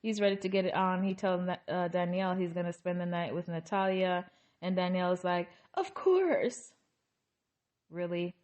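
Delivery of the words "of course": much higher, put-on cheerful "Of course"; fake enthusiasm. Danielle feigning she's fine with Garrick's night, high and falsely cheery. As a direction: sing it out as if you don't mind. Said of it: "fake enthusiasm".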